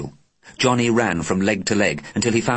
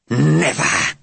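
defiant alien; 6 semitones higher, 4 dB louder than usual. Acted.

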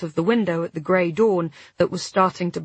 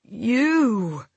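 pained groan of a reply; 2 semitones higher than usual. A narrator's reading. Injured man answering his partner, groaning. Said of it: "he groaned".

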